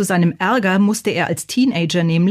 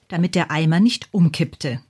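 usual speaking voice

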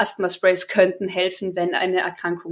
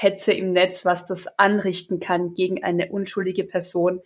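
normal talking voice

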